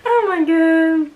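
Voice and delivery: slow, high, loud Oh my god; slow high astonished oh my god; stunned disbelief. Real, not acted. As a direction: drag it out in amused shock. Reacting to her own hair.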